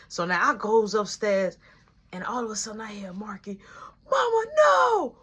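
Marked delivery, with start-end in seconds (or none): 4.1-5.2 brother's high, slow crying scream; retold scream. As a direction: cry out no like a scared kid. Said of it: "he's screaming for dear life".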